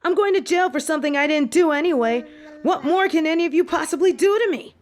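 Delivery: high and fast, daring her to do worse; defiant anger. Acted. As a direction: snap back with bitter defiance. Defying the headmistress.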